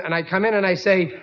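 ordinary talk, nothing marked

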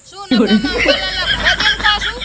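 loud, laughing sarcasm; mocking laugh. Farmer mocks Ambalal's forecast to his friend, loud and laughing.

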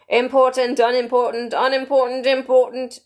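high, even chant of alternatives; King testing words. A narrator's reading. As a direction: weigh each word aloud in a royal voice. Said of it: "trying which word sounded best".

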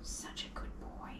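breathy whispered praise to the dog; soft whisper. Real, not acted. Groomer whispering praise to a nervous dog. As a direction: whisper it to calm him.

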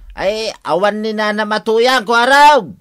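high, loud call toward the door; loud call to the debt collector outside. Acted. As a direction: shout the lie out to the yard. Lying to collector, calling outside.

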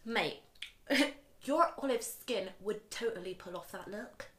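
put-on reading a text in Somerset accent.

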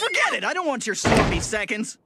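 loud disgusted refusal; disgusted shout. Acted.

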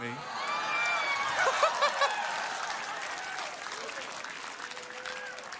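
long high whooping laugh; roaring joyful laugh; big shouted laugh. Real, not acted. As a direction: burst out laughing at the pulpit. Explodes at atheist's prayer story.

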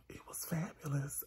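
breathy whispered gush. Real, not acted. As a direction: hush it out, overcome. Whispered gushing about the parade.

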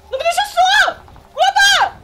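high frantic yelling; panicked calling. Real, not acted. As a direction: call out in a panic.

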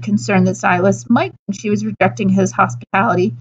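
ordinary delivery, nothing marked

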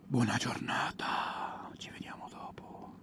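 soft whispered goodbye. Real, not acted. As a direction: whisper it like a secret.

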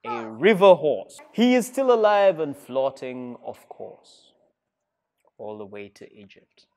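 put-on lively, sing-song read-aloud for kids; animated storybook narration; reading to children. A narrator's reading. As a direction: tell it like a storybook to a class. Reads picture book to children.

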